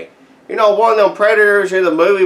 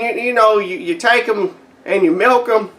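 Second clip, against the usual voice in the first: ordinary talk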